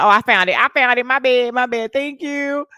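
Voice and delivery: imitating someone, loud and high; loud quoted exclamation. Acts out what he said.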